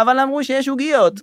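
lightly voiced, high-pitched protest; imitated protesting patient. Retold old patient protesting to shouting staff. (חיקוי קל של מחאה תמימה)

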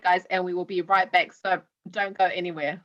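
ordinary talk

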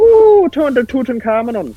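high, loud sing-song doorbell chime. Pretend. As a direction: ring it out like a doorbell. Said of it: "they go".

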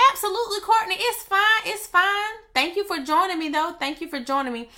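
a squeaky mock-cheery reply; playful high voice. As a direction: pitch way up, teasing and sweet. Playfully answering a viewer comment.

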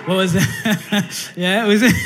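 breaking up with laughter; laughing. Real, not acted. Preacher teasing a church member about an audition, laughing hard. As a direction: barely get the words out for laughing. Joking about Brother Nelson's song.

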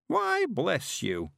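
put-on high, slow creaky old man voice; an eager old countryman, chuffed; old Mister Weevil.

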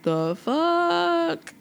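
shocked imitation; mock confused listener. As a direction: act thrown by the Spanish. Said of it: "and you're like".

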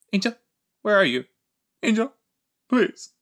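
high-pitched pleading call; mock plea. Voicing a character searching for Angel, pleading.

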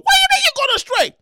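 high, loud incredulous yell; outraged shout. Pretend. Host self shouting at writer alter ego. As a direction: shriek in disbelief. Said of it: "the two alter egos, they battle".

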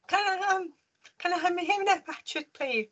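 put-on high, small child asking nervously; shy little girl asking for batteries; timid child voice. Schoolgirl timidly asking the head teacher for batteries. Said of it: "And I just went".

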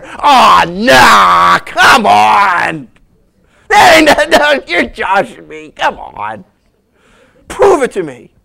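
loud hammed-up protest; disbelieving shout. Priest refusing angel's news.

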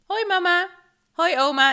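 high, loud, bubbly hellos; excited child greeting. Retold or imitated. Girl meeting her mother at the airport, excited greeting. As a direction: call out happily like a little girl.

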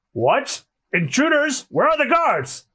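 high, startled questions; acted alarm, pitch leaping up. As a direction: demand the guards in mock alarm.

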